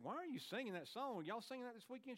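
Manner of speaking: high, light, quizzical voice; recounting a curious question to his wife; retold questioning. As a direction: ask it pitched well up, without raising the volume. Retells questioning his wife about singing.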